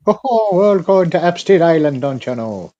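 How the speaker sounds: put-on a light Wisconsin suburban mom accent. Joking about Epstein Island in a Wisconsin mom accent. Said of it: "sounds like a suburban mom for Wisconsin".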